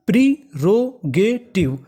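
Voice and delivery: प्रीरोगेटिव very slow, in four separate beats; stretched, broken-up प्रीरोगेटिव; syllable by syllable. Shown as a demonstration. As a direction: sound out प्रीरोगेटिव piece by piece. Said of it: "तो पूरी meaning".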